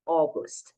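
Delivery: August said with an O sound, ogost; teacher correcting a sound; model pronunciation. Shown as a demonstration. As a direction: start August with an open O, not au. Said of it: "a pronúncia é O".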